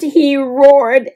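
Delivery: ordinary delivery, nothing marked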